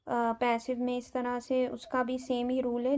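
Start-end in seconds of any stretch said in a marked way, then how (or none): none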